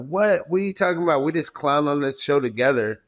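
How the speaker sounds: high-pitched shocked protest; shocked disbelief. Retold or imitated. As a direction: recoil in disbelief at the coach. Retold shock at coach.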